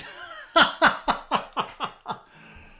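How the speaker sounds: high, loud bursting belly laugh; big laugh. Real, not acted. Streamer asked to impersonate his wife, high loud laugh. Reacts to impression request.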